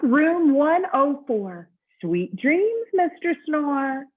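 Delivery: high, slow, sing-song goodnight; bellhop voice. Acted. Bellhop bidding Mister Snore goodnight, high and slow. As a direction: tuck him in like a cheerful bellhop.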